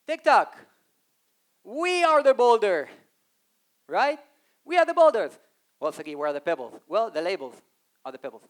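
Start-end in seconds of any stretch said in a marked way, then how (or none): 0.1-5.3 boastful brand voice. Acted. Playing TikTok boasting.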